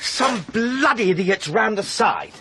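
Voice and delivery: slow, high, loud annoyed outburst; furious at the careless rowers; irritated. Acted. Angry at the other boat.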